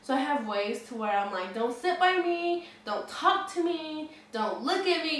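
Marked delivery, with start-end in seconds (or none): 1.5-5.1 slow, higher-pitched mock warning. Rider warding off strangers on the bus, acted warning. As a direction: draw out each command to keep someone away. Acting out her warning-off stance.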